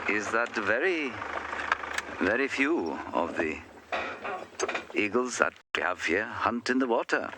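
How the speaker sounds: high, lilting go at a Welsh accent; badly done Welsh accent with swooping pitch; attempted Welsh accent. Shown as a demonstration. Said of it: "Welsh accent that I can muster".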